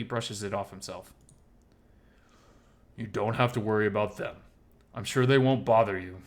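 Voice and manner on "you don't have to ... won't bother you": louder, assured promise; firmly reassuring. Acted. Oswald assuring Miles about the two men, firm. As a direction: brush the worry aside with force.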